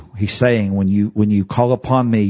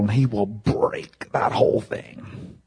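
hushed whisper; 5 dB quieter, slower than usual. Real, not acted. Preacher drops to hush.

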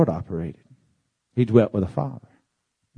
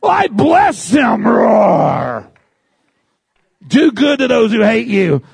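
high, strained, shouted pleading; flustered believer; 10 semitones higher, 3 dB louder, slower than usual. Retold or imitated. Believer forcing himself to bless an enemy, high strained shout. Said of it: "you're all flustered".

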